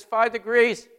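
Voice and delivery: loud, high call-back; wheelsman's reply. Retold or imitated. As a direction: report the order done, firm and clear. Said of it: "he says".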